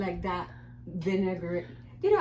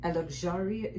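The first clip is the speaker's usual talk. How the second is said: ordinary talk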